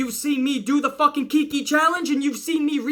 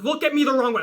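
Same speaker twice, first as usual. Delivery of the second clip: normal talking voice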